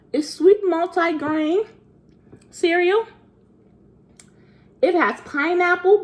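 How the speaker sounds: high-pitched pretend kid voice; seven-year-old self. Reviewing fruity cereal for viewers, pretend seven-year-old voice. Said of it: "transitioning to my seven-year-old self".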